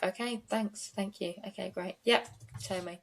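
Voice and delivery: soft, subdued mock concession; meek backing down. Lawyer giving up on a firm witness, meekly backing down. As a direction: back off quietly, voice dropping away.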